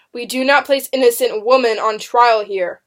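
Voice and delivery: loud, authoritative courtroom rebuke; firm order. A narrator's reading. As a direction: state it forcefully as a judge. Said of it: "Benjamin Fire ordered".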